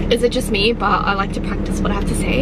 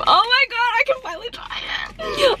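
very high-pitched, gushing delight; thrilled squeal; 11 semitones higher than usual. Real, not acted. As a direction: squeal with long-awaited joy. Finally gets long-awaited food.

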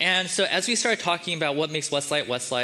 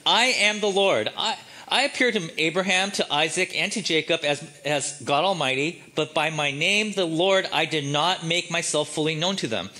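voice of God; 2 semitones higher than usual. Shown as a demonstration. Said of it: "I'll, I'll talk like this".

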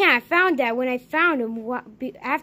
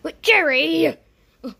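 normal talking voice

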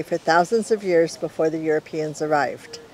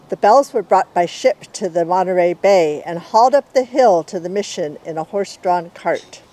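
usual speaking voice

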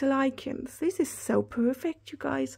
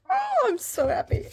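delighted squeal; 3 dB louder than usual. Real, not acted. Delighted by bean card.

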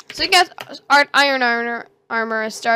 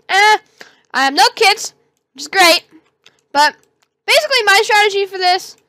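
usual speaking voice

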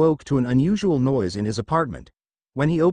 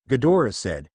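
usual speaking voice

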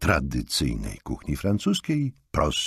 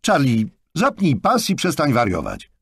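higher, louder fatherly command; stern order; 11 semitones higher, 5 dB louder than usual. A narrator's reading. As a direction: order the boy to buckle up, firmly. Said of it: "rozkazał synkowi Jonathan". (surowy rozkaz ojca)

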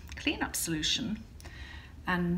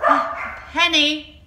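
higher, louder call to the dog; 8 semitones higher, 8 dB louder than usual. Real, not acted. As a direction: call out to Henny across the room. Calling to the dog.

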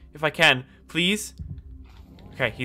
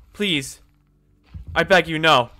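ordinary talk, nothing marked

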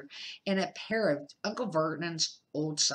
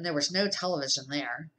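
ordinary delivery, nothing marked

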